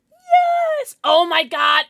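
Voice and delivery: loud high triumphant cheer; excited yes. Real, not acted. Celebrating in game.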